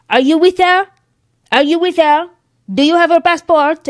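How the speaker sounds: high, loud mock-French official; French accent. French customs officer asks past a wheelchair traveler, loud French accent. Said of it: "Your French accent".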